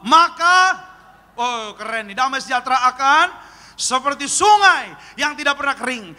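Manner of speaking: loud, rising preacher's reading; scripture reading. Acted. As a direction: proclaim the verse to the congregation. Reads scripture aloud. (pembacaan ayat berapi-api)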